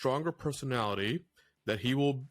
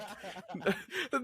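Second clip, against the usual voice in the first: a burst of high, amused laughter; 8 semitones higher, 4 dB quieter than usual. Real, not acted. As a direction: laugh out at how obvious it was. Laughing at an open secret.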